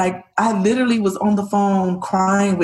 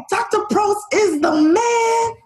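excited cheer; 12 semitones higher than usual. Retold or imitated. Mother joyfully cheering about doctor.

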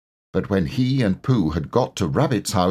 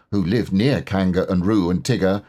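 usual speaking voice

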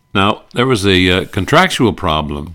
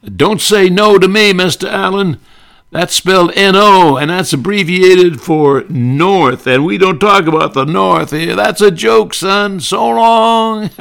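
much higher, loud Southern blowhard; Southern senator; 13 semitones higher, 7 dB louder than usual. Retold or imitated. Senator Claghorn bragging about the South, loud and high. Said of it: "he would tell Fred".

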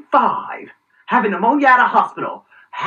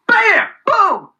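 punchy cartoon explosion; mock explosion boom; 10 semitones higher than usual. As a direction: blurt it like a blast going off. Chain of infection spreading.

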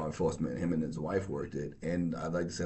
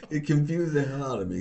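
ordinary delivery

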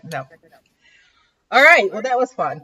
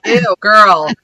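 sassy disapproval; 7 dB louder, much slower than usual. Retold or imitated. Imitates a disapproving French speaker.

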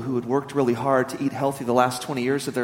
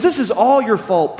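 angry blame; 6 semitones higher, 5 dB louder, slower than usual. Acted. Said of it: "getting angry".

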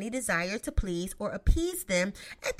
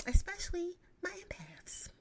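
whispered aside; 7 semitones higher, 5 dB quieter than usual. Real, not acted. Sad aside.

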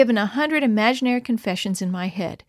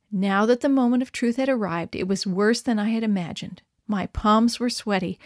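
ordinary delivery

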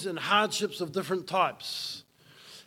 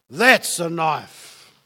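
slow, lower, falling "that's a knife" with THAT'S hit hard; weighty punchline; 3 semitones lower, 6 dB louder, slower than usual. Shown as a demonstration. Preacher to congregation, landing the contrast slow and heavy. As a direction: drop it slow and heavy like the real thing. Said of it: "It's a contrast".

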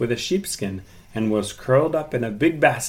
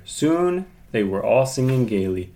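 ordinary delivery

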